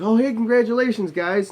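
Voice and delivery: high, loud gushing greeting; excited congratulations. Retold or imitated. Acquaintance congratulating the two friends at Walgreens, mock-excited and high.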